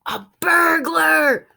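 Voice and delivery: loud, high, slow frightened cry; gasped alarm. Acted. Dog realising a burglar is in the house, alarmed cry. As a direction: blurt it out in alarm. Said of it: "she gasped".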